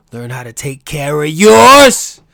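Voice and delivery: rallying shout. Real, not acted. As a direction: belt it out like a rallying cry. Rallying listeners to protect their own.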